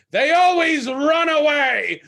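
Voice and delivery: high, loud, slow and eerie with a laugh; creepy dancer voice. Retold or imitated. Dancer speaks to fleeing friends.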